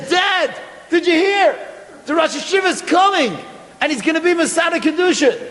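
bursting through the door with news; joyful announcement; excited shout. Retold or imitated. Son runs home shouting news.

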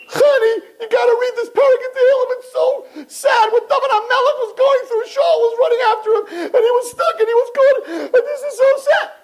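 very high, hurried hammed-up wail; mock crying. Girl calls friend about a sad Tehillim, mock sobbing. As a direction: blubber it out in exaggerated sobs.

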